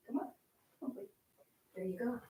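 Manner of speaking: usual speaking voice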